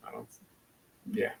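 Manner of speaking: ordinary delivery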